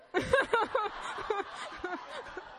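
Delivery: bright rising giggle; long high laugh. Real, not acted. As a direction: laugh delightedly. Laughs at husband's answer.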